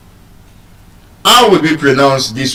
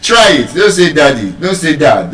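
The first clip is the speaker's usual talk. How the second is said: normal talking voice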